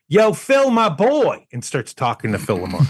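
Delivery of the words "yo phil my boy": eager fan greeting. Retold or imitated. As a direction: call out to Phil like an old pal, much higher. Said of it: "immediately goes".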